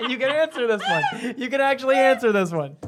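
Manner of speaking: high-pitched, laughing permission; teasing laughter. Real, not acted. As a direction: squeal it through laughter, teasing him. Teasing Robert about never answering.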